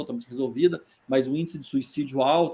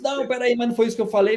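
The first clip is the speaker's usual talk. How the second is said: higher, protesting it wasn't what she said; indignant woman; 7 semitones higher, faster than usual. Retold or imitated. Offended audience woman cutting off Boal, higher and insistent. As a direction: object in disbelief, voice up.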